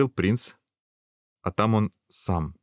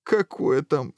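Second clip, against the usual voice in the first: higher, mildly tearful protest; a touch of a whimper; sobbing; 7 semitones higher, slower than usual. A narrator's reading. Prince denying to the guard he jokes, mild sobbing. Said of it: "всхлипнул принц".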